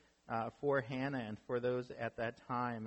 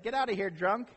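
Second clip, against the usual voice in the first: higher and louder imitated dismissal; mock brush-off; 7 semitones higher, 5 dB louder than usual. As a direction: play the rude churchgoer, pitched up. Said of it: "I walked over like".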